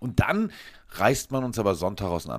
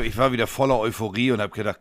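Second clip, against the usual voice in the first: ordinary talk, nothing marked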